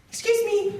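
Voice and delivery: high timid call. Retold or imitated. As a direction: squeak it out politely from the bed. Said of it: "and I'm like".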